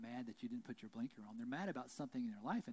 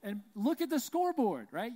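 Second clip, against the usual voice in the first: shouted high, taunting; taunting the other team's parent about the score, shouted; loud taunt; 9 semitones higher, 11 dB louder than usual. Retold or imitated. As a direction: rub the score in his face, loud.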